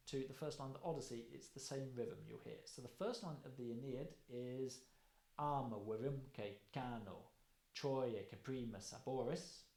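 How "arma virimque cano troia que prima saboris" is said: slow, measured Latin hexameter; Latin verse recital. Shown as a demonstration. Lecturer reciting Virgil by heart, slow and rhythmic. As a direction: perform the Aeneid line from memory. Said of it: "I'll perform it".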